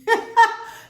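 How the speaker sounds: loud high cackle; real loud cackling laugh. Real, not acted. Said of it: "The way I cackled".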